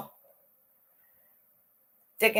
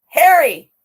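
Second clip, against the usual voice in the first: usual speaking voice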